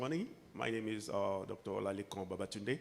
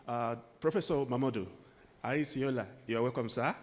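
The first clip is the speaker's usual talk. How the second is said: louder, ceremonial speech delivery; formal address; 4 semitones higher, 8 dB louder than usual. Acted. Speaker greets dignitaries at the conference, formal speech voice. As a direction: open the dialogue like a keynote at a podium.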